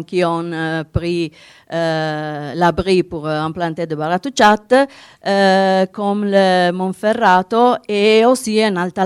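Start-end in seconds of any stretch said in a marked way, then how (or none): none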